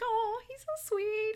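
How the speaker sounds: high, drawn-out coo; gushing. Retold or imitated. Retelling own reaction to John's sweetness, gushing.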